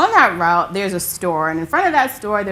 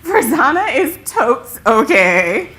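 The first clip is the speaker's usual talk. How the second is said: laughing; 4 semitones higher, 7 dB louder than usual. Retold or imitated. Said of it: "in between laughter".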